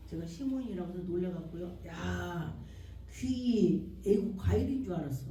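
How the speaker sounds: ordinary talk, nothing marked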